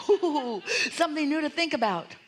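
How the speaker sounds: high, bright excited delight; thrilled by a new problem. Retold or imitated. Guide voicing Esther thrilled by the problem, excited and high.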